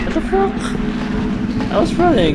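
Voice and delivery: high, loud startled shout; startled yell. Real, not acted. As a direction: yell out in shock at a scare. Jump scare in horror game.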